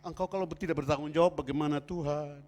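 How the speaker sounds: ratapan doa yang diperagakan; pura-pura merengek malu kepada Tuhan; menirukan tangis. Retold or imitated. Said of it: "mulai nangis". (menirukan orang mengadu sambil menangis)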